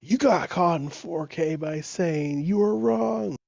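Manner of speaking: high, drawn-out mock gloating; teasing dad. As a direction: imitate a dad gleefully teasing, stretching the words. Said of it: "he started laughing. He goes".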